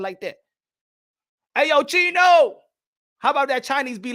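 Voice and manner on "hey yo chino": loud, drawn-out street hail; calling out. Acted. Customer hailing the Chinese restaurant man, calling out. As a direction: holler it high and long.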